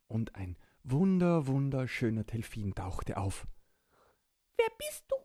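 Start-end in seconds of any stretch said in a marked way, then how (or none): 4.6-5.2 high squeaky little creature voice; small child voice. Acted. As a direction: speak like a tiny excited child. Borki asks the dolphin.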